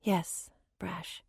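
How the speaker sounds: breathy hushed whisper, barely voiced; soft whisper. A narrator's reading. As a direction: whisper it tenderly to him. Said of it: "she said softly".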